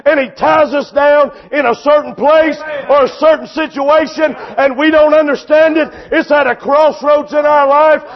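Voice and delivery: loud, driving sermon delivery; full-force preaching. Real, not acted. Preacher to congregation on troubles and the tied colt, full-force preaching. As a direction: preach it hard, punching key phrases.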